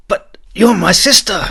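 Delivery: high, loud disbelieving cry; exclaiming. A narrator's reading. Prince crying out to his sister in disbelief. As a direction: cry out that she can't mean it. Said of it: "he exclaimed".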